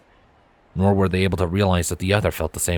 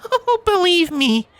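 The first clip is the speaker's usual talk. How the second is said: smirking Twilight; slower than usual. A narrator's reading. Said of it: "Twilight said with a smirk".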